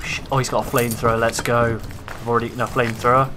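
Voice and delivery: normal talking voice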